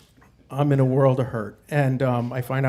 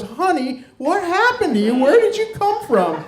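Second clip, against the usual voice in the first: rising higher with each line, a shocked night nurse; 12 semitones higher, 4 dB louder than usual. Retold or imitated. Nurse gasping at the patient's scars, high shocked voice. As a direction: play the stunned nurse, pitch climbing. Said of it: "She goes".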